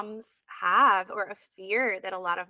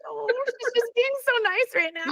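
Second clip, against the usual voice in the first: much higher, gushing voice; flustered inner voice; 3 dB louder than usual. Retold or imitated. Consultant voicing her own flattered thoughts, gushing. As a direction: squeal it like you're flattered. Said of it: "now I'm just like".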